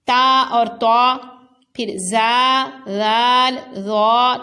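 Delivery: تا light and thin, طا heavy and full, each sounded loud and apart; زا, ذال and ظا each given its own distinct articulation; teacher sounding out تا, طا, زا, ذال, ظا distinctly; similar letters contrasted. Shown as a demonstration. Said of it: "تینوں حروف میں واضح فرق ہو".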